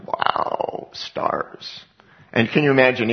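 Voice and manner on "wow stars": breathless whispered wonder at the night sky; a soft whispered wow; awed whisper. Retold or imitated. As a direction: hush it out, amazed by the stars. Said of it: "and you just go".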